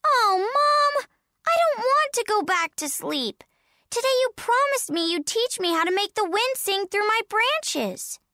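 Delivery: put-on whiny little child, very high; high, pleading child whine; Baby Pine. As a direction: protest to mom in a high kiddie voice. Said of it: "Baby Pine".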